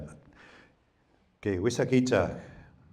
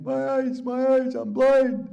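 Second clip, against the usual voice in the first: high, loud anguished wail; crying out; 6 dB louder, slower than usual. Retold or imitated. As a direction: cry out high for his lost eyes. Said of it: "and he's crying".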